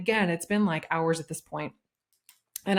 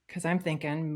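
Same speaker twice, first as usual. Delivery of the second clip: usual speaking voice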